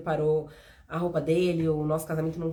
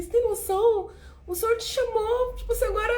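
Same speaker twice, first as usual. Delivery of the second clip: excited disbelief; 14 semitones higher than usual. Retold or imitated. Retells tearful joy to husband.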